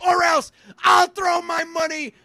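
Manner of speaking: put-on high, whining toddler voice; whiny baby tantrum. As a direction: wail it like a spoiled child. Mock whiny subscriber tantrum.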